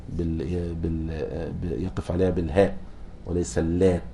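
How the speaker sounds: usual speaking voice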